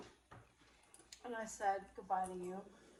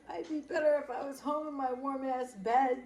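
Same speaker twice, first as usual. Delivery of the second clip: loud, higher-pitched mock outburst; worked-up retold rant, loud and high; retold angry grumble; 6 semitones higher, 10 dB louder than usual. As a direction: complain loudly, re-enacting your annoyance. Retold angry complaint to coworker.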